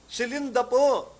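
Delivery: high, loud and slow; excited announcement. Retold or imitated. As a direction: blurt out the news. Sister bursting with news.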